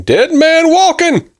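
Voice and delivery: high, drawn-out mock scream; screaming greeter. Door greeter announcing a married man at Walmart, mock screaming. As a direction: holler it like a hammed-up public announcement. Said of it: "the door greeter starts screaming".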